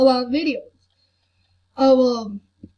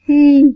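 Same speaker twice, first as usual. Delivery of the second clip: hmm; 3 semitones higher, 14 dB louder than usual. Retold or imitated. Retold hesitation sound.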